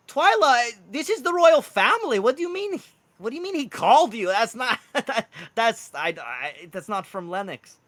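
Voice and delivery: high, loud, flustered Welsh protest; harsh Welsh accent. Acted. Mushroom man objects that Lennox didn't call, spluttering Welsh accent. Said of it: "He has this harsh Welsh accent".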